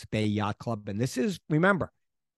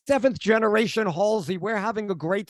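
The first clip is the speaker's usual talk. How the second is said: high, excited crew cheer; excited crew member yelling; 9 semitones higher than usual. Retold or imitated. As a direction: shout it like a happy sailor on deck. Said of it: "yeah, like".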